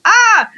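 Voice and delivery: high mock wail of overwhelm; acted high-pitched "ah" of panic; freaking-out cry. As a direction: let out a high, exaggerated cry. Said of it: "I'm freaking out".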